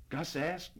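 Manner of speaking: plain reading voice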